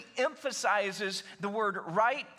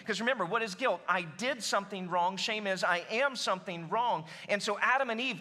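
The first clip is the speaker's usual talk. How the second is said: ordinary delivery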